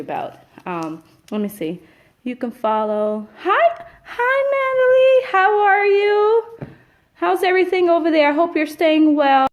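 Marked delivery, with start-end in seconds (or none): 3.4-9.5 excited greeting. Real, not acted. Greeting a viewer warmly.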